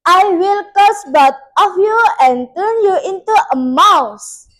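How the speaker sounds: loud, high, fast curse; threatening witch. A narrator's reading. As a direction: hurl the threat at them with force. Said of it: "said with a threatening voice".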